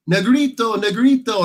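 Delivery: playful high-pitched impression of girls calling; imitated calling out. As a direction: lightly imitate girls calling after someone. Girls chasing him, calling out.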